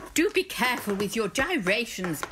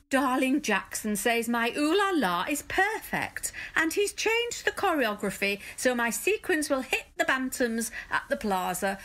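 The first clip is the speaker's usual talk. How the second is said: normal talking voice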